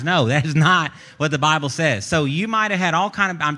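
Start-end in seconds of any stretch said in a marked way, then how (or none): none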